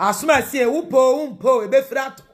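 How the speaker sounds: high, loud preached warning; preaching climax. Real, not acted. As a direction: ring it out like a sermon peak.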